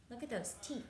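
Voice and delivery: ordinary talk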